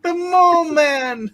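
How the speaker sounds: slow booming mock announcement; dramatic shout. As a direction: proclaim it like a movie title.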